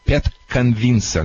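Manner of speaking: ordinary delivery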